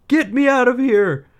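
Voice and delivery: very high, loud cry to get out; desperate shout. A narrator's reading. Aladdin begging the genie to free him, shouting. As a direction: yell it as a frantic boy.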